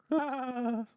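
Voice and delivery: very high, thin giggle; high-pitched put-on laugh; awkward laugh. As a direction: titter high in character. Acted awkward laugh in game.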